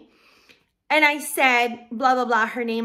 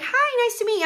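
cheery greeting; 11 semitones higher than usual. Retold or imitated. Greeting the other balloon decorator.

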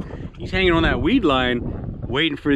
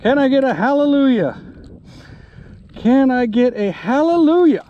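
joyful call; 9 semitones higher, 6 dB louder than usual. Real, not acted. Joyful after catching big fish.

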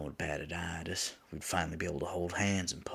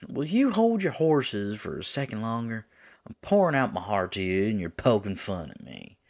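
louder, higher-pitched exasperated outburst; indignant lover; 4 semitones higher, 8 dB louder than usual. Acted. Demon scolds teasing angel lover mid-confession, exasperated. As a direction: protest at being teased mid-confession. Lover pleads while being teased.

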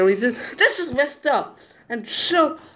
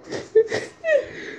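high squeaky laugh; 14 semitones higher, 3 dB quieter than usual. Real, not acted.

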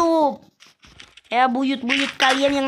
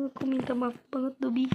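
much softer, fast murmured sorry; hushed apology; 11 dB quieter, faster than usual. Real, not acted. Apologising quietly to the dead panda. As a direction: say it under your breath.

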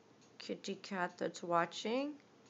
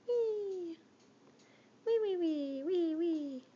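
high playful squeal; 8 semitones higher, 3 dB louder, slower than usual. Real, not acted.

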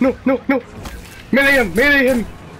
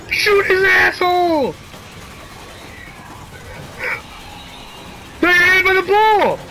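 urgent high-pitched yells mid-fight; excited shouting; 7 semitones higher, 3 dB quieter than usual. Real, not acted. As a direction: call it out in a panic. Frantic firefight in the game.

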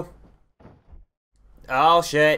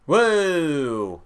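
Ric Flair woo; 2 semitones higher than usual. Retold or imitated. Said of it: "I can't do it like Ric Flair".

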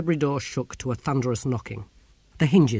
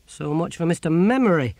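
mocking dismissal; 5 semitones higher, 4 dB louder than usual. Ern mocks failed memory test.

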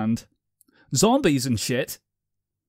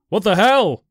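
loud, higher yelled shock; scream; 7 semitones higher, 9 dB louder than usual. Acted. Narrator jolting back from the haunted sound, screaming. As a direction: shout it out in fright. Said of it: "I screamed".

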